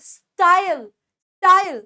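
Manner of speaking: "style" as one gliding "sta-yl"; correct pronunciation. Shown as a demonstration. Teacher models style for learners, correct pronunciation. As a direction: punch style with a smooth eye-l glide. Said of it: "स्टाइल नहीं बोलना".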